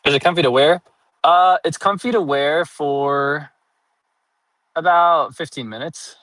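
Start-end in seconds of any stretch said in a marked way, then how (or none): 1.2-6.1 drawn-out answer. Real, not acted. Delayed punchline about comfort.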